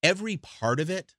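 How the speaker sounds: ordinary delivery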